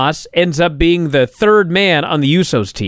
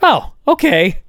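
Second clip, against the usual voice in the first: mock casual shrug; 6 semitones higher than usual. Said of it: "And Miz goes".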